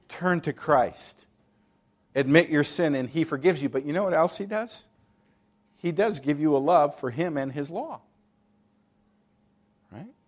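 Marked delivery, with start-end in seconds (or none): none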